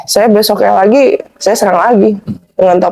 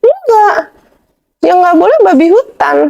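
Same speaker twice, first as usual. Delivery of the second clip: retold defensive protest, pitched up; 8 semitones higher than usual. Guest retells defending himself in a debate over pork, defensive. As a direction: mildly protest in a higher voice. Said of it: "Saya membela diri".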